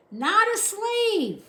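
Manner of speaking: slow, high, loud shout. A narrator's reading. Soldier shouting slowly to deaf Mig. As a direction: bellow it word by word. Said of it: "shouted the soldier".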